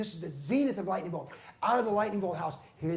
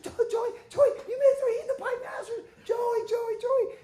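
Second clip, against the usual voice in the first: excited shouting; 5 dB louder than usual. Retold or imitated. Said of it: "Billy says, like".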